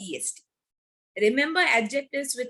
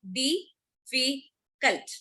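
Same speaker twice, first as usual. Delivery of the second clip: "Difficult" very slowly as "di-fi-cult", a pause between syllables; much slower than usual. Shown as a demonstration. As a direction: count out the three syllables of "Difficult". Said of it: "Three syllables".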